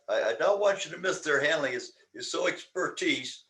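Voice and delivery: usual speaking voice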